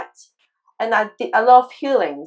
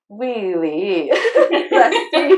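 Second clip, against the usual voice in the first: laughing disbelief; slower than usual. Retold or imitated. Reacting to the healer's claim.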